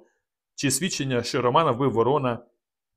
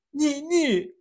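high, strained woman's cry of denial; a young woman refusing to believe; high anguished no, no. A narrator's reading. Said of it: "застигли в тузі".

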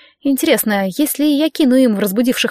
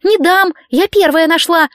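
high, louder stubborn protest; 7 semitones higher, 4 dB louder than usual. A narrator's reading. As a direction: dig in and refuse to give it up. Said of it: "уперлась девица".

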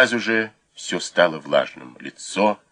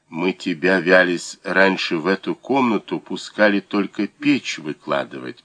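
usual speaking voice

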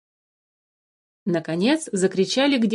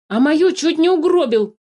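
high, agitated reproach; nervy outburst; 8 semitones higher, 4 dB louder than usual. A narrator's reading. Philipp reproaches the changeling over his life, agitated outburst. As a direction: throw the blame back heatedly. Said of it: "нервно постукивая палкой".

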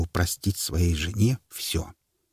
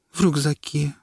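sulky; 8 semitones higher than usual. Acted. Wife answers sulking.

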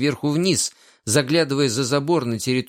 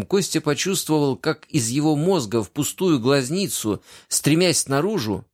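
ordinary delivery

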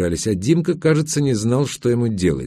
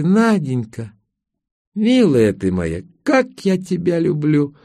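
пьяная нежность; 7 semitones higher, slower than usual. A narrator's reading. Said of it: "расплылась в широченной улыбке".

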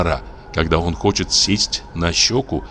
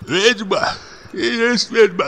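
tearful muffled voice; slower than usual. A narrator's reading. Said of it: "глухим, плачущим голосом".

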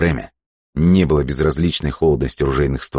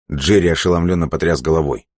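ordinary delivery

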